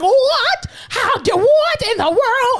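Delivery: hammed-up shocked mother; shocked mom. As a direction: gasp it out as the stunned mother. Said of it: "she was like".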